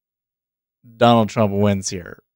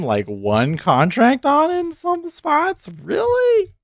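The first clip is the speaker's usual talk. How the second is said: incredulous; 3 dB louder, slower than usual. Real, not acted. Shocked at thin market depth.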